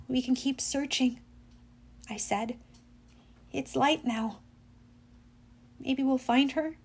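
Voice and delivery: soft, halting suggestion; mildly grieving, hushed tone; subdued hope. A narrator's reading. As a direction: offer faint hope quietly, with long pauses. Grieving hero clings to hope.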